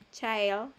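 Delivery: put-on "child" with the same vowel as "science". Shown as a demonstration. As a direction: model "child" carefully as "chai-ld". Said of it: "como science". ("child" pronunciando la I como "ai")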